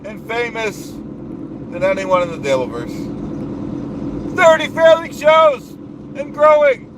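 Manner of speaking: blustering self-important boss voice; pompous ranting persona. Acted. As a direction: puff up like a bragging strongman. Holds the Duke persona rant.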